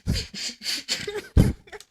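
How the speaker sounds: squeaky airy laugh; high wheezing laugh; high breathless laugh. Real, not acted. Reacting to twist.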